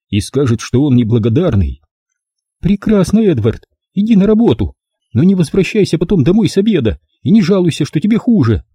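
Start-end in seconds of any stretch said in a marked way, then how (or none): 2.6-8.6 high, fretful mother's voice; a doting mum sure he is ill; mother worrying about the fog and his weak chest, fretful; fussing mother. A narrator's reading.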